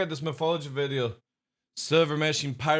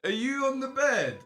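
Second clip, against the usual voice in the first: high pet voice; talking to the dog; 8 semitones higher, 4 dB louder than usual. Real, not acted. Owner asking his howling husky what's wrong, high pet voice.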